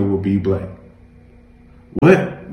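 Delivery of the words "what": shocked high gasp of a word; sharp startled cry. A narrator's reading. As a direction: jolt up in disbelief. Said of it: "Jameson gasped".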